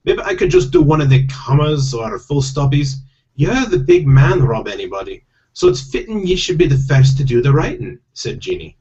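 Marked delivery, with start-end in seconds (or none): 0.0-3.0 higher-pitched protesting Scottish pixie; hammed-up Scottish voice, reluctant; Scottish accent. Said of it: "I do have to do Scottish accents". 3.3-8.0 high, insistent Scottish wife; higher, louder stage-Scottish voice; Scottish accent. Acted. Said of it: "I do have to do Scottish accents".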